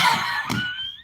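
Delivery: very high drawn-out squeal; overcome, let out a high-pitched squeal. Real, not acted. Touched by sister's message, high squeal.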